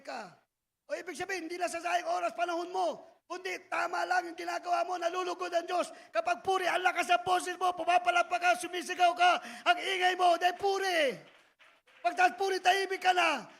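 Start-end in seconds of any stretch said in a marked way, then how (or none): 3.7-13.5 loud, driving preacher's voice through every line; loud preaching. Acted. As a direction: preach it like the praise itself, full volume. Loud preaching about noisy praise. (malakas at masiglang pangangaral)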